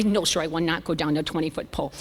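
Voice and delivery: low, subdued obedient reply; meek compliance. Retold or imitated. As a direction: answer like a chastened patient. Her obedient answer to surgeon.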